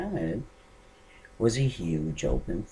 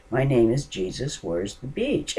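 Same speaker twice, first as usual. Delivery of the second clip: a lightly raised retelling of the shout; repeating; 5 semitones higher, 3 dB louder than usual. Retold or imitated. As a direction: say it again, only a little raised. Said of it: "kept saying to me over and over".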